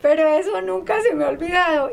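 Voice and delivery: a moved laugh that nearly cracks; laughing through tears. Real, not acted. Remembering the friend who helped her family, teary laugh.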